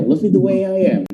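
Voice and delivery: quick, run together as "way-yai-yam"; brisk connected phrase. Shown as a demonstration. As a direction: say "Love me the way I am" fast in one breath. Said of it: "Itu akan lebih cepat bacanya".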